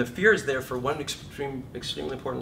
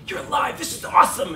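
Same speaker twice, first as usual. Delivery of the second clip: higher, louder thrilled cry; joy voice; 8 semitones higher, 9 dB louder than usual. Acted. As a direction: burst with delight at being alive. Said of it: "your joy voice is going".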